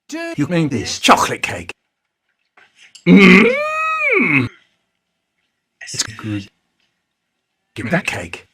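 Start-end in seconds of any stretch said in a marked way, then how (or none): 3.1-4.5 delighted mm. Acted. Savouring stolen cake.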